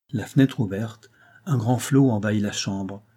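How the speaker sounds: plain reading voice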